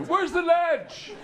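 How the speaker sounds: high, loud, stretched cries for help; mock panicked swimmer. Irish swimmers two-thirds down the pool, hammed-up panicked wail. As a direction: wail it high, as if going under. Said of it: "and then they go".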